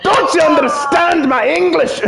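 high, slow, exasperated Scottish shout; hammed-up Scottish indignation; Scottish accent. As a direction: cry out in a broad Scottish brogue. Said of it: "like, a more Scottish accent".

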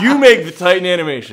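fast, loud, laughing order; laughing command. Pretend. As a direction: bark it out while laughing.

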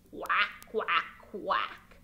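low quacking duck voice; duck quacks. Acted. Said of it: "And I say what?".

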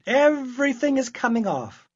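high, loud reply from the tub; called-back yes. Acted. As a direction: call out from the bath. Girl calls back from bath.

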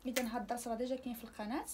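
ordinary talk, nothing marked

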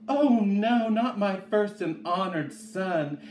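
high, loud outburst of alarm, easing off; alarmed, high-pitched exclamation; dismayed mother's cry. A narrator's reading. Mother hears son fell in well.

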